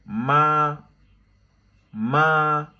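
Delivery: ma held high and level; first tone ma. Shown as a demonstration. Teacher demonstrating the first tone, high level ma. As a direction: sing ma flat and high like a straight line. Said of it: "comment lire ma?".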